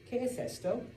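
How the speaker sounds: ¿Qué es esto? said up high. Shown as a demonstration. Teacher repeating the model question to class, high. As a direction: lift the question bright and high. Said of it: "So we say".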